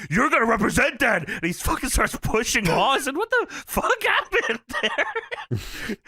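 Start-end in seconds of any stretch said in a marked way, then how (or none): 0.1-1.2 high, excited mock outburst; super fired up. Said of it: "wide-eyed, super fired up". 3.2-6.0 high, drawn-out incredulous outburst; mock bewilderment. Acted outburst while retelling shove.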